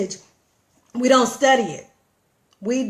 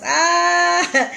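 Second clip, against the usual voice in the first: high, loud, drawn-out "ah"; Mississippi country holler; 7 semitones higher, 6 dB louder than usual. Pretend. Vlogger showing off her country voice, loud Mississippi holler. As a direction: belt out a long country holler. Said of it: "in my Mississippi country voice".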